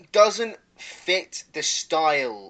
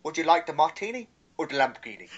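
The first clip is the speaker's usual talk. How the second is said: lightly imitated British accent, quick then trailing softer; mock British accent; 3 semitones lower, 4 dB quieter, faster than usual. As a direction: mimic a friend's limited British accent. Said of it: "the only thing you could do would go".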